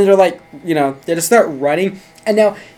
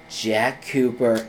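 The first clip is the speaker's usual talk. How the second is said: low, slow, softer hero recognition; grave Danton; 7 semitones lower, 6 dB quieter, slower than usual. Retold or imitated. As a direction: say the name deep and drawn out, like an action hero. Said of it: "Danton's all like".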